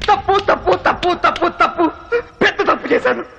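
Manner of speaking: mocking repetition. Villain mocking his own mistake.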